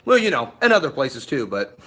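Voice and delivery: ordinary talk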